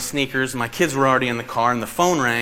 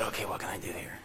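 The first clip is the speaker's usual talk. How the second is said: whisper; 5 semitones lower, 15 dB quieter, faster than usual. Retold or imitated. Thinking aloud during call.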